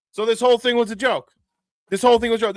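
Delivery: the penny drops, half laughing; stunned realization. Real, not acted. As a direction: blurt it out as it dawns on you. Realizing the April Fool's joke.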